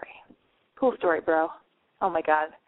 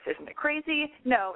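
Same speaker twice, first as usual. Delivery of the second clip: ordinary talk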